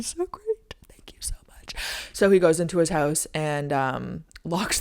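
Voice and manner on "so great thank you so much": breathy pleading whisper. Retold or imitated. Begging the neighbour for a car, soft whisper. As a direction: whisper the thanks as a hopeful plea.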